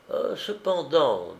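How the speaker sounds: drawn-out, tentative second thought; slight hesitation. A narrator's reading. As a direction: stall a little before speaking. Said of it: "avec un peu d'hésitation".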